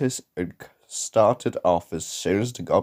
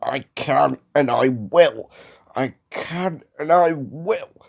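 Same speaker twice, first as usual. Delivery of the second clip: higher, determined effort; puffing engine; 5 semitones higher than usual. A narrator's reading. James answering the jeering trucks, puffing determinedly. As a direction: puff it out with stubborn resolve. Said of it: "puffed James".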